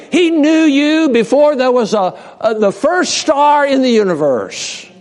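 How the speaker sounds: ordinary talk, nothing marked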